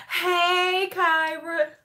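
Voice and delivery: high, drawn-out sing-song hey; calling out greeting. Real, not acted. Greeting Kyra who just wandered over, high drawn-out call. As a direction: call out sweetly on a high held pitch.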